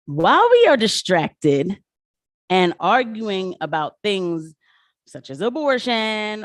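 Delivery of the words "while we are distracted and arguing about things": loud, slow, every word weighted; slow emphatic warning. Real, not acted. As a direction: drive the warning home to the viewers. Stressing a political warning.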